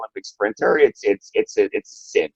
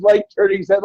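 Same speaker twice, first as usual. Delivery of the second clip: usual speaking voice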